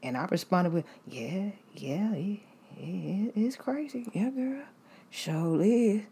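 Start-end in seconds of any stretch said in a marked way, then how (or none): 1.1-6.0 awkward hushed agreement. Retold or imitated. Her own awkward reply.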